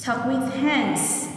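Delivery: "Talk with hands" said in one slow, smooth sweep; slow whole phrase. Shown as a demonstration. Teacher linking the words for pupils, slow and smooth. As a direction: join it up slowly, as one phrase.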